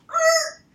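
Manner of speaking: sudden high loud yelp; the dog balks at the exam room door; panicked dog yelp. Retold or imitated. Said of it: "they're like".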